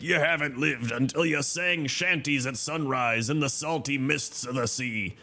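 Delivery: put-on pirate voice, slowing at the end; Quartermaster Rowley. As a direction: play the salty shanty-singing sailor. Quoting an old sea dog.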